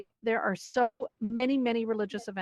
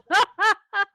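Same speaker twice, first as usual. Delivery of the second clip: bright laugh; 10 semitones higher, 7 dB louder than usual. Real, not acted. Laughs at odd event name.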